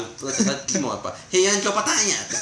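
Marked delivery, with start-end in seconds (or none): 1.3-2.1 imitating a Kansai-dialect line, high and loud; 関西弁. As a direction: laugh through a punchy Kansai-dialect quote. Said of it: "ちょっと実は関西弁ですよね". (笑いながら高く声を張った関西弁の真似)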